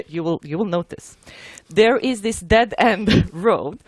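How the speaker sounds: normal talking voice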